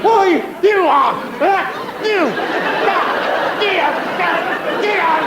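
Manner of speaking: high, spluttering mock outrage; splutter, too furious to finish the sentence; speechless with rage. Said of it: "I can't even speak".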